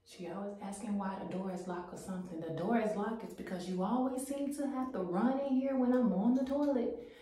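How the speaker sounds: muffled behind a closed door; from another room. Real, not acted. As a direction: voice through the bathroom door. Mom talks from behind door.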